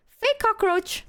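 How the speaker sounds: high deadpan prank announcement; silly high announcement. Retold or imitated. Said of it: "I pointed at it and I went".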